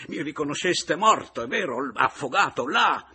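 loud, high, savage taunting; ferocious shouted reproach; fierce accusation. A narrator's reading. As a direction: hurl it at them, rising. Continues shouting with fierce joy.

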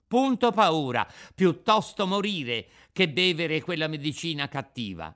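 high, loud stubborn boast; defiant puppet child. Acted. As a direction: declare it loudly, high and stubborn. Puppet defies fear of death.

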